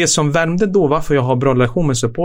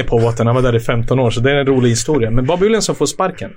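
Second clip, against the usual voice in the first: ordinary talk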